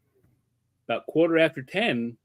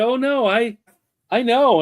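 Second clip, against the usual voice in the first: eager protest; 9 semitones higher, 5 dB louder than usual. Retold or imitated.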